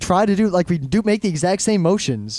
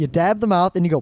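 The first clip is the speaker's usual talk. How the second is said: usual speaking voice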